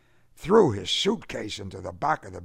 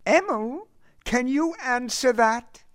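high-pitched matronly voice; shy motherly woman; 14 semitones higher, 3 dB louder than usual. A narrator's reading. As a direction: call to him in a high, motherly voice. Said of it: "whispering woman, shy and motherly".

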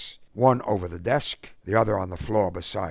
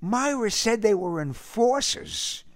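a young man, voiced clearly higher; Eddie's young voice. A narrator's reading. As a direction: speak up as the young Eddie, tense. Eddie quotes to K O.

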